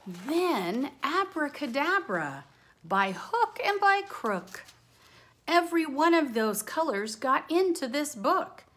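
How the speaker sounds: put-on lively rhyming read-aloud for kids; bouncy picture-book voice; reading to children. A narrator's reading. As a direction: read it like a storybook to a class.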